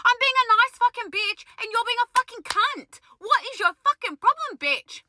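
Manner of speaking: high-pitched, exasperated rant; indignant telling-off. Retold or imitated. Lash lady confronting the rude cafe lady, indignant. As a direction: have it out with her, voice pitched right up.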